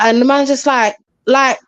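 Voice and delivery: ordinary delivery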